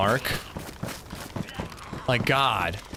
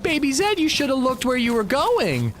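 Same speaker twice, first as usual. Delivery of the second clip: put-on high, sing-song baby talk; talking to a toddler; 11 semitones higher than usual. Dad to toddler daughter after her fall, high sing-song baby talk. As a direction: coo it up high like scolding a toddler sweetly. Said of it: "I'm like".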